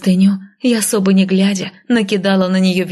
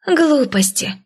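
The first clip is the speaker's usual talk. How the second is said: high, dismissive exclamation; stubborn exhale; 9 semitones higher, slower than usual. A narrator's reading. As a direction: brush the argument off stubbornly. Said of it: "упрямо выдохнула Лестра".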